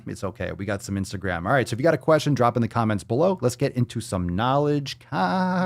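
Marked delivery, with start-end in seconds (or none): 3.4-5.7 slow, stretched signature line; drawn-out catchphrase. Real, not acted. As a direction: lean into the catchphrase slowly.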